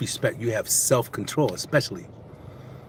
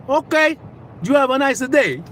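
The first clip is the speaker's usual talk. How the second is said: put-on high, sing-song Italian accent; suddenly agreeable mock Italian; imitated Italian driver; 12 semitones higher, 3 dB louder than usual. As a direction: send him off in a hammed-up Italian voice. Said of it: "He's Italian".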